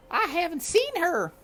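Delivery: high, loud shrill-voiced maid yelling; high loud maid's shout; shouting servant woman. A narrator's reading. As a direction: bellow it like a fed-up servant. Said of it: "Lewis was shouting".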